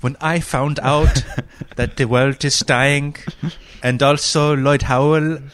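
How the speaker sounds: put-on high, loud, drifting accent parody; a clumsy Norwegian accent turning Indian; Norwegian accent slipping Indian. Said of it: "I'm kinda going Indian with this".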